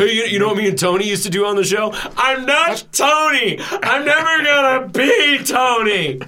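hammed-up shout; mock outburst. Mock outburst about replacing Tony.

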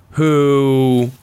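normal talking voice

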